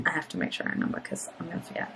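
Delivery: fast whisper, talking to herself. Real, not acted. Vlogger reminding herself while reading visa rules, quick whisper. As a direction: rush it out in a whisper as a note to self.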